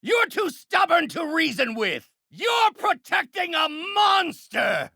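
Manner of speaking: high, outraged villain yelling; furious shouting. Acted. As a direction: yell at your stubborn opponent. Arguing in a fight.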